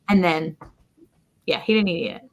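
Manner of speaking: normal talking voice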